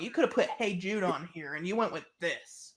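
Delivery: ordinary delivery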